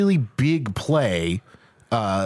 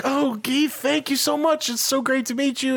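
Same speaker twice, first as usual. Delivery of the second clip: higher, breathless gushing thanks; gushing Helen; 12 semitones higher than usual. Retold or imitated. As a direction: play the starstruck young actress, much higher. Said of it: "and she's like".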